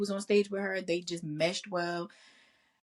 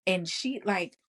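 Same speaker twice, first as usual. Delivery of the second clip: usual speaking voice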